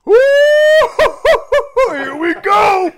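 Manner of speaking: high excited whoop, stretched slowly; gleeful whoop. Retold or imitated. Coworker whooping as the troublemaker walks back in. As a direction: cheer like a fight is coming. Buddy cheering on the fight.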